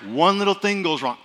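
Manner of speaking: ordinary talk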